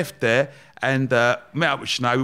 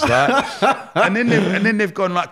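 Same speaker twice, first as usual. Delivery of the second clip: normal talking voice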